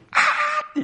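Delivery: 尖声模仿孩子大叫; 用全力高声尖叫的童声; imitating a child's full-force shriek. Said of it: "ハンナは全力で叫ぶんです".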